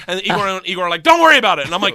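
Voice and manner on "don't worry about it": higher, loud acted brush-off; dismissive gangsters. Gangsters brushing off the police threat, loudly dismissive. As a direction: wave it away loudly.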